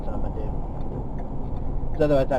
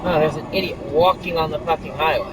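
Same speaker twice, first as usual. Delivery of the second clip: loud, raised angry shout; road rage yelling; 5 semitones higher, 6 dB louder than usual. Real, not acted. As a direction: yell at the idiot on the road. Yelling at driver.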